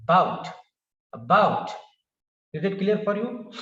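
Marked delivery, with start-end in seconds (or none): 0.0-0.5 "About" with a loud BOUT, first vowel nearly swallowed; stress on second syllable. Shown as a demonstration. Teacher shows where stress falls, "About" with strong BOUT. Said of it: "The stress is on the second syllable". 1.1-1.7 "About" as weak a, strong falling BOUT; stress on second syllable. Shown as a demonstration. Teacher repeats the example for learners, "About" stressed on BOUT. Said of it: "The stress is on the second syllable".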